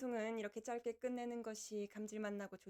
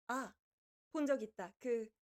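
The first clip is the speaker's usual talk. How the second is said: usual speaking voice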